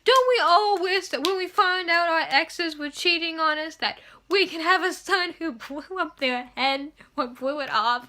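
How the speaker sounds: lightly voiced doting mother; mock Rowena. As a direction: swoon like a proud mother. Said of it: "Rowena was like".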